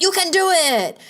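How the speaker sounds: high, bright pep cheer; mock cheerleader pep. Cheerleader pom-poms cheering on.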